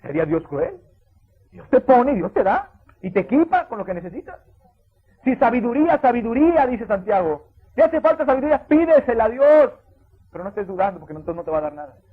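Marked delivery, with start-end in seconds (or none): 1.5-9.7 loud, high, slow pulpit exhortation; preaching at full force. Acted. Pastor urging the church to ask God, full force preaching. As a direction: lift the congregation with each line.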